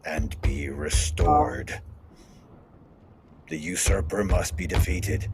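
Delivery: slow, measured in-character plea; deliberate pronouncements with long pauses; solemn, insistent Legion. Acted. Legion demanding the cartographer be left behind, solemn and slow.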